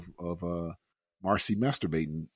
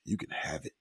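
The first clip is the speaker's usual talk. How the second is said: breathy seductive whisper; sexy voice; 4 semitones lower, 11 dB quieter than usual. Retold or imitated. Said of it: "she had to say in her sexy voice".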